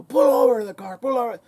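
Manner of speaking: loud high yell out the car window; shouting pull over. Retold or imitated. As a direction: bark the order like a jumpy young cop. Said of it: "and shouting".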